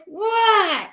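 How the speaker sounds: very high imitated screech back; yelled reply. Dawn answers Ian from her front door, mock high yell. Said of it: "She goes".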